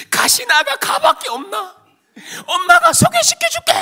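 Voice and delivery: very high, shouted mother impression; indignant mother. Mother promising her son another girl, indignant shouting. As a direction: cry out in loud, protective indignation.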